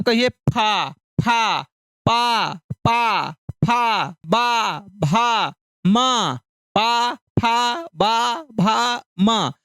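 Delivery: पा, फा, बा, भा, मा spaced out slowly, lips pressing together; lip sounds demo. Shown as a demonstration. Teacher has children repeat the lip consonants slowly. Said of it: "कैसे बोलते हैं आप?".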